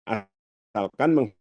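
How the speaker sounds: signal cutting out mid-sentence; broken, dropping connection; choppy breaking audio. Real, not acted. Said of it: "suaranya putus-putus". (suara terputus-putus)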